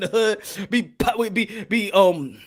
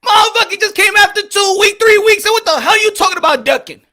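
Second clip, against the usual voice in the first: loud, higher, furious yelling; angry rant; 11 semitones higher, 9 dB louder than usual. Real, not acted. Vlogger yelling at fans who say Haney is ducking, furious. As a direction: yell at the fans in disbelief.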